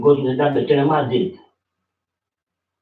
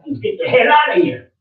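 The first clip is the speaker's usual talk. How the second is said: real anger, pitch jumping high; raised voice of a heated family quarrel; angry outburst; 10 semitones higher, 5 dB louder than usual. Real, not acted. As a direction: order them out in a high, angry burst.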